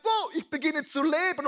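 the little bird waking up thrilled; excited bird. Acted. Little bird thawing out, calling out in joy. Frozen bird suddenly comes alive.

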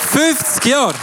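normal talking voice

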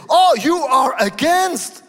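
high, loud and slow accusing cry; mock accusation. Pastor mimicking critics with a loud accusing cry. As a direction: throw the accusation back at them. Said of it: "people say".

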